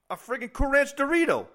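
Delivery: high, loud outraged shout; loud exasperated outburst. Retold or imitated. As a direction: yell it in disbelief. Outraged punchline.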